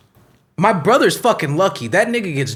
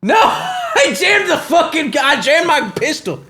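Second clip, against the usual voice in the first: high, wailing cry of dismay; mock-distraught shout; 10 semitones higher than usual. Gun-toting cop whose pistol jammed, mock-distraught shout. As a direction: yell in over-the-top frustration.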